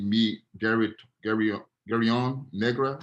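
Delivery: unsure, restarting "Garyon Negra" with an English accent; halting Spanish attempt. Real, not acted. Teacher who doesn't speak Spanish tries the phrase, halting mispronunciation. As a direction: stumble through "Gary, Gary, Garyon Negra" in English sounds. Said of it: "I don't speak Spanish".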